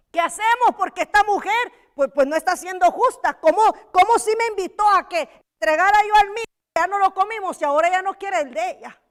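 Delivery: loud, fast, high indignant complaint; angry mother's plea. Acted. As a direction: voice the outraged mother demanding justice. Angry mother pleads to king. (madre indignada reclamando al rey, en voz alta y rápida)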